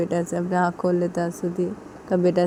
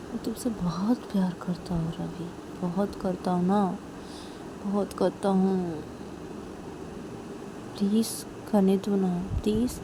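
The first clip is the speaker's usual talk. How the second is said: soft pleading; 7 dB quieter than usual. Acted. Rahul confesses love to Ravi.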